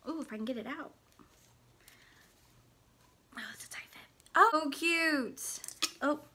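soft unvoiced whisper. Real, not acted. Whispering while unboxing on camera. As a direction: hush it to the camera.

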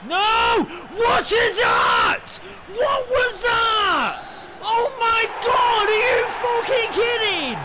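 very high, loud, slow disbelieving shouts; furious scream. Real, not acted. Gamer just conceded, screaming furious disbelief.

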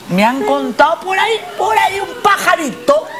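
rising high playful shout; teasing shout. Real, not acted. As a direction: tease her with a big reveal. Teasing build-up to surprise.

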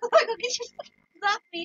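ordinary delivery

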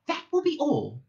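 high, final mouse order; firm dismissal. A narrator's reading. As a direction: end it firmly.

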